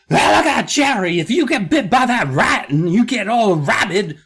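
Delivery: a kid sister done as a hammed-up sportscaster; Harry Carey voice. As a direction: bark it like a goofy old baseball announcer. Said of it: "I don't know why I'm giving her that voice".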